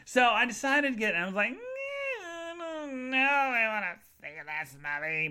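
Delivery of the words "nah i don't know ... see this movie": slow, high, drawn-out whine; whiny reluctance. Retold or imitated. Library visitor wavering over a movie, whiny reluctance.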